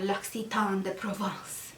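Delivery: mock fancy French accent; lightly put-on French accent, for fun; faux French accent. Naming the brand to viewers, in mock French accent. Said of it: "I gotta say it in the fancy French, faux French accent".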